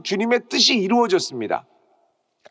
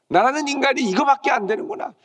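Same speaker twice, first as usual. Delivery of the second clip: raised lament; 7 semitones higher than usual. Acted.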